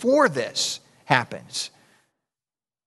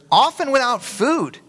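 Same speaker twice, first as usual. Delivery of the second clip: mock dramatic rise; 10 semitones higher, slower than usual. Reading scripture, voice jumps up.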